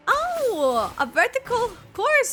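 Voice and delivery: high, loud, impressed outburst; excited exclamation. Real, not acted. Streamer seeing the course, excited exclamation. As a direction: burst out, pitched well up.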